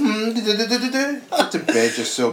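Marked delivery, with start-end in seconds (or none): 0.3-1.2 high imitated guitar riff; very high "do-do-do" jingle; toy guitar jingle. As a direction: mimic an electronic toy tune. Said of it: "guitar plays".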